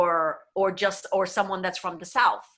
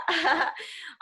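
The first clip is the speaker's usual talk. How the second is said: short laugh; 8 semitones higher than usual. Real, not acted. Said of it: "laughs after demo".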